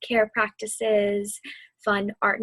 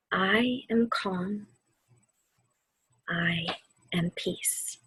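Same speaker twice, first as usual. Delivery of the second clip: slow mantra; 2 semitones lower, 4 dB quieter, slower than usual. Acted. Offers a mantra to repeat.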